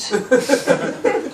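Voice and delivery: laughing at the absurd question; high burst of laughter. Real, not acted.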